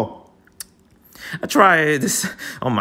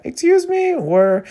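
much higher, sassy outrage; high mock indignation; indignant. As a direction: play the affronted coach.

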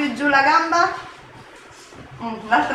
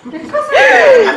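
high, loud laughing surprise; startled laughing question; surprised; 11 semitones higher, 5 dB louder than usual. Real, not acted. Finds an odd object, laughing. (sorpresa, ridendo)

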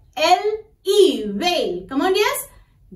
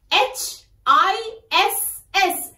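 usual speaking voice

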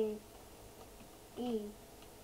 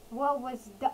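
ordinary talk, nothing marked

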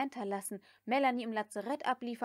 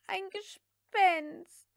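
high, slow, drawn-out moan of fright; a maid groaning in terror at a ghost; frightened moan; 9 semitones higher, 6 dB louder, much slower than usual. A narrator's reading. Prissy moans in terror, high and drawn out. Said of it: "stöhnte Prissy".

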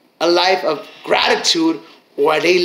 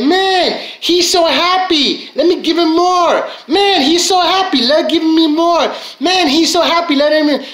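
high-pitched, gushing delight; gush, eager to hand out more; delighted God; 8 semitones higher than usual. Acted. God reacting to a grateful person, delighted. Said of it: "God's gonna be like".

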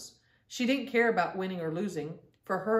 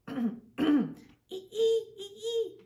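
high mouse squeaks, with a laugh; squeaking; 12 semitones higher, much slower than usual. Acted. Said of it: "Lainey squeaked".

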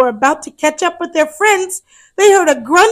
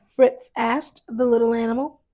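plain reading voice

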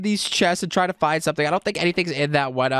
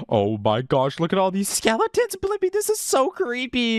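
high, uneasy exclamation; creeped out; 6 semitones higher than usual. Real, not acted. Gamer showing Blippi skeletons, creeped out. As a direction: pitch it up in fright.

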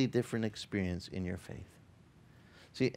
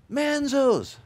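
higher, louder call across a hall; 11 semitones higher, 4 dB louder than usual. Retold or imitated. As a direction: shout their name across the room. Waiter calling their name.

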